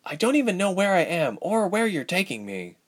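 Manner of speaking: lightly voiced, confused young woman; young heroine, bewildered. A narrator's reading. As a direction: protest that you are lost. Narrator voices Ilse.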